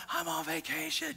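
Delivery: high-pitched mock panic; panicked protest. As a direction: act out a distressed tourist's complaint. Panicked tourist protesting in the raft.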